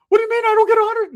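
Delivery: very high, loud comic protest; cousin's put-on voice. Cousin learning he gets no money, high loud squawk. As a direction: squawk it in disbelief. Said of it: "Why does he talk like that?".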